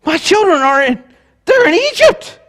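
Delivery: high, surprised, loud mock panic; a flustered deity caught off guard, hammed up; panicked God. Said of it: "God was in heaven going".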